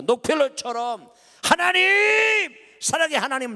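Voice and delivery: loud, rising sermon cry; fervent preaching. Acted. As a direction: preach it out to the whole church. Preacher rouses the congregation.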